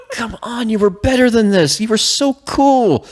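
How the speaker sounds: high, whiny mock heartbreak; hammed-up pleading with a fallen friend; dismayed pleading. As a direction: wail it in comic disappointment. Acted pleading with Atris.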